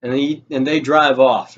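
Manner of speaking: ordinary talk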